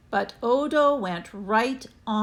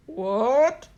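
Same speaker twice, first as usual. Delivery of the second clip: policeman; 3 semitones higher, 4 dB louder than usual. A narrator's reading. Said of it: "said the policeman".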